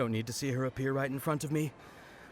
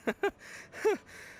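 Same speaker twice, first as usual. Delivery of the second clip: embarrassed giggle. Acted. Embarrassed at being caught.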